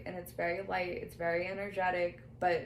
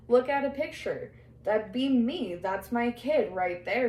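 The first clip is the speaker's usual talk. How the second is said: louder, eager retelling of the spirit's words; excited spirit quote; 3 semitones higher, 6 dB louder than usual. Retold or imitated. Medium relays a spirit showing off the photo, excited and louder. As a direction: push it out proudly, a little raised. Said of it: "she keeps going".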